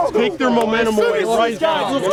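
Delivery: fired-up coach shout. Real, not acted. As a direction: fire the team up, shouting. Coach firing up team.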